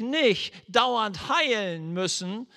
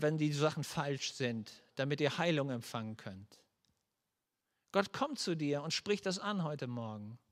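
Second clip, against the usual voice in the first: softer, hushed pastoral prayer voice; prayer invitation; 3 semitones lower, 3 dB quieter than usual. Acted. Pastor inviting the congregation to pray, softly. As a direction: draw the congregation gently into prayer.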